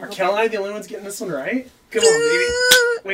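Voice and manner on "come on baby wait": high, slow coaxing voice; talking to a dog. Real, not acted. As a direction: coax the puppy sweetly. Talking to a puppy.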